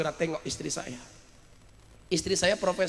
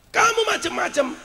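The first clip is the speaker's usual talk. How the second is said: sharply raised divine warning; Allah threatening; 5 dB louder than usual. Acted. God warning Miftah He can topple him, commanding threat. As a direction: pitch the threat high and forceful. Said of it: "kata Allah".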